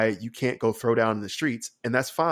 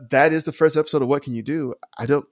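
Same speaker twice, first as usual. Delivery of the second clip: usual speaking voice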